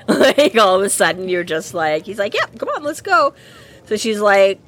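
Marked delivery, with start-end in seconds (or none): 2.3-3.3 high, bouncy, overly eager; cheery eagerness. Retold or imitated. Dresden cheerfully urging the faerie to transform him. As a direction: chirp it like a happy dog.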